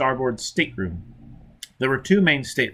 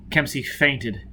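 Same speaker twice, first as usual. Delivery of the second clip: ordinary delivery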